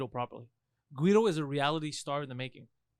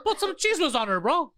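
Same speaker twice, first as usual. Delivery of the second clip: loud sharp voice; 12 semitones higher, 7 dB louder than usual. Pretend. Voicing Guido.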